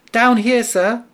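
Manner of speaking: high mouse voice calling up; little mouse calling. A narrator's reading. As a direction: call up from below like a tiny mouse. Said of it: "said the mouse".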